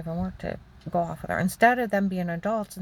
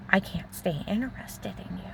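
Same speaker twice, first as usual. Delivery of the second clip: hushed retold remark; 6 dB quieter than usual. Husband rejecting wife in bed, retold in a hushed half-whisper. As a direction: say his words softly, nearly whispered.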